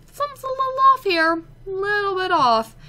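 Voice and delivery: high, quick puzzled mock voice; inner voice. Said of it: "I was just like".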